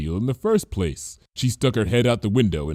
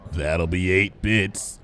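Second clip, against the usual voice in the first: slow, bored museum pony, a little lower; dull voice; 3 semitones lower, slower than usual. A narrator's reading. Museum cashier to a nervous visitor, slow and dull. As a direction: drone it out slowly. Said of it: "in a dull voice".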